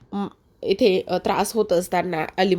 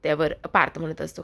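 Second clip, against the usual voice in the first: usual speaking voice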